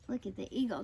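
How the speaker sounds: ordinary delivery, nothing marked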